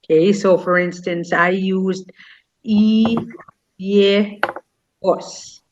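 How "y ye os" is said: broken into separate syllables, yegos. Shown as a demonstration. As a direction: say "y- ye- os" one syllable at a time. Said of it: "I pronounced it syllable by syllable".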